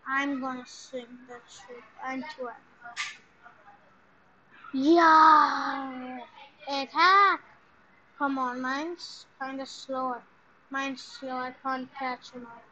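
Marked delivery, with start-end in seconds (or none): 4.7-7.4 loud charge yell; rally the crew to attack; battle cry. Real, not acted.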